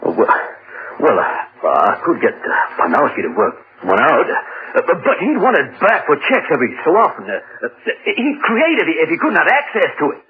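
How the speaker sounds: stammering, climbing high as excuses pile up; hesitate, then protest in a high fluster; flustered stalling. Acted. Blackmailed man making excuses about the android, flustered.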